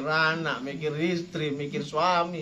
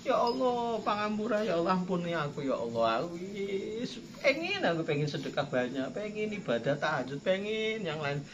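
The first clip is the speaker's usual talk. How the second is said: pretend crying; 6 dB quieter than usual. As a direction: plead with God in a mock-weeping whine. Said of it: "pura-pura".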